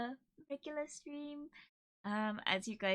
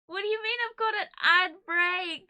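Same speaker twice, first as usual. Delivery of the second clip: usual speaking voice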